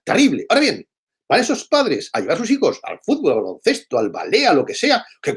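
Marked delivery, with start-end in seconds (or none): none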